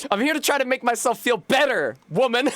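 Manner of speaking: put-on exasperated reply to the chatty old woman; mock exasperated retort. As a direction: fire it back at her, fed up.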